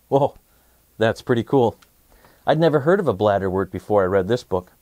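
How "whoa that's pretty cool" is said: ordinary delivery, nothing marked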